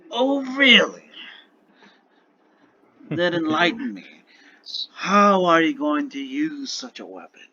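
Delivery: theatrical surprise, drawn out; mock surprise. Dragon asks the human about his weapon, acted surprise. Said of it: "just gonna look surprised".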